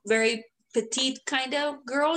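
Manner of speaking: usual speaking voice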